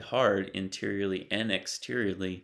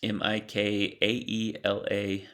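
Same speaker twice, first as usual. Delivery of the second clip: ordinary talk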